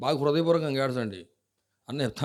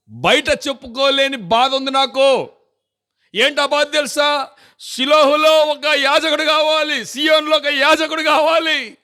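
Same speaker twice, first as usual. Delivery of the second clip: much higher, louder, slow pleading preach; full-force preaching; 11 semitones higher, 8 dB louder, slower than usual. Acted. Preacher crying out Hannah's plea for a priest, full-force preaching. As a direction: cry out Hannah's longing to the church.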